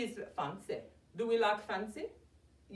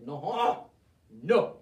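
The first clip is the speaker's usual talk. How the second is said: firm no; 8 semitones lower, 4 dB louder than usual. Retold or imitated. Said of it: "And I said".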